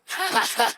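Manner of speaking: high laugh. Acted. Reader laughs mid-monologue.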